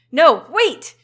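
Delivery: high, loud cry to stop them; panicked shout. Acted. Kid cries out as the monsters attack, loud and panicked. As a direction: beg them to stop, too late.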